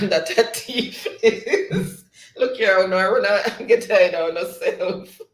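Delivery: real laughter breaking into the words; amused, laughing read-aloud; laughing while reading. Real, not acted. Laughs while reading chat messages.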